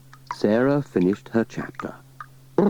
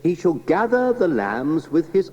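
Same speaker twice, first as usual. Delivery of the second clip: vicar voice; 6 semitones higher than usual. Acted. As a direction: read scripture like a mild country vicar. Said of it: "said the Reverend Timms".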